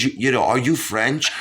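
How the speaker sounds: put-on a light French accent asking a question; a French doctor asking, in a touch of a French accent. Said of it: "She goes".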